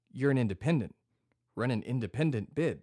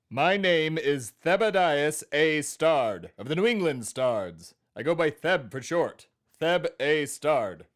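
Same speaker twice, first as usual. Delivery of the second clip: loud, slow, grand New England pronouncement; quasi-New England accent; 5 semitones higher, 9 dB louder, slower than usual. Acted. Introducing himself to confused co-host, quasi-New England accent. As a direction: announce the family name in old New England tones. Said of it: "quasi-New Englandy accent you've got going on".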